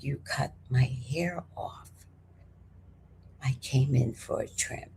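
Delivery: low, whispery reproach; contained anger, barely above a whisper; hushed accusation. Retold or imitated. Client confronting hairdresser over a trim, hushed.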